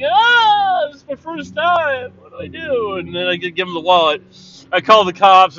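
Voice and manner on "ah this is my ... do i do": panicked tourist. Retold or imitated. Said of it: "I'm like".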